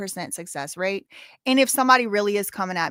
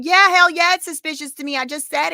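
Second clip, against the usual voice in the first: much higher and louder hell yeah; exasperated shout; 7 semitones higher, 7 dB louder than usual. Real, not acted. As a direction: blurt it out, fed up. Exasperated answer to viewers.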